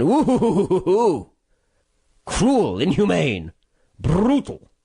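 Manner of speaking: mock outrage. Pundit mocking the panel's reaction with fake shock. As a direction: ham up being scandalised by his own words.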